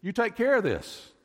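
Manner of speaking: Jesus commanding the disciples, insistent; firm command. Acted. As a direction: hand the task back with force. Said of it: "he looks at him and says".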